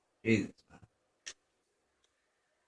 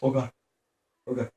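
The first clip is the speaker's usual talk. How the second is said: ordinary talk, nothing marked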